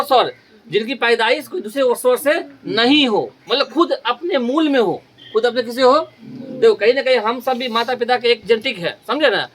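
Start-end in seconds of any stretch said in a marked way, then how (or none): none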